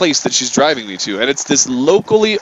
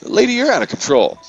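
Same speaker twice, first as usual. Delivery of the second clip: retold accusation; 7 semitones higher than usual. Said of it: "the literal words I say were".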